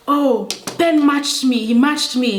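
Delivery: re-enacted child's grievance; imitated tattling. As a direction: whine it to the teacher like a wronged kid. Child complaining to teacher.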